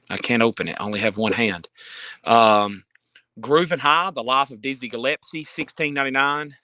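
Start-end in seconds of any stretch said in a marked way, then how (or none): none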